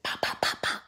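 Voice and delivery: hushed fantasy punches, breathless whisper; whispered pop pop. Retold or imitated. Picturing hitting her partner, whispered pop pop pop. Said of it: "in my head".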